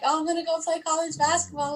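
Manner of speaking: high-pitched mock enthusiasm; mock dreamy boast. Mocks an idea they never had.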